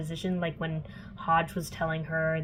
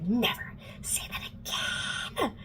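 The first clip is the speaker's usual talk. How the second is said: slow, drawn-out threatening whisper; furious whisper; 4 semitones higher, 5 dB quieter, slower than usual. Retold or imitated. Alec slams Clary against the wall, slow furious whisper. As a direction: whisper it slowly, as a menacing warning. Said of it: "like, really mad and grabs her".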